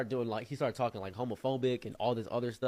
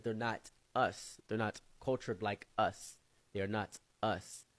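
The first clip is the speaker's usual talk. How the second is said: slow, deliberate and a bit softer; slow firm verdict; 4 dB quieter, slower than usual. Retold or imitated. Friend declares Americans are not like them, slow and deliberate. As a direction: lay it down slowly.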